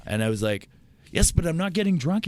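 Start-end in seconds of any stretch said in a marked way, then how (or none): 1.1-2.2 exasperated retort. Retold or imitated. Arguing back, exasperated.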